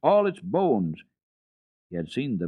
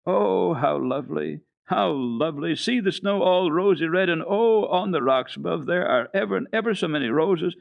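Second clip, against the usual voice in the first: marvelling; 8 semitones higher, 3 dB louder than usual. Acted. Heidi marvels at the sunset.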